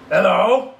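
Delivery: loud, gruff feigned growl; mock growl. Scrooge feigning his old gruffness at Bob, growling. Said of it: "growled Scrooge in his accustomed voice".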